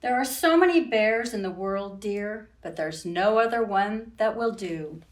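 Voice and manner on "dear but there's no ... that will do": ordinary talk, nothing marked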